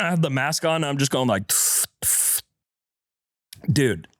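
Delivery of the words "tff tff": imitated huffing.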